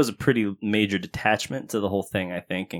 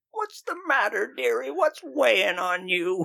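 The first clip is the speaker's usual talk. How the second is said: put-on very high, slow old-lady voice; little old lady; 13 semitones higher, slower than usual. Undercover old lady coaxing a crying mourner, high and slow. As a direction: draw it out high like a doting granny. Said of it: "a little old lady with an umbrella".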